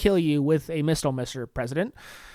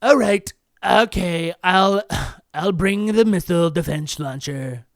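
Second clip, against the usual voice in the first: Donald reply; 4 dB louder, slower than usual. Retold or imitated. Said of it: "Donald to Melania".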